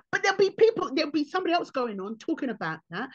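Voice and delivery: normal talking voice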